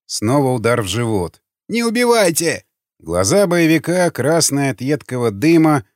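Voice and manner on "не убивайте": high frightened begging; wailing plea. A narrator's reading. Gunner kicked in the stomach, wailing plea. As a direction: cry out for mercy, pitched high. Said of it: "продолжал причитать пулемётчик".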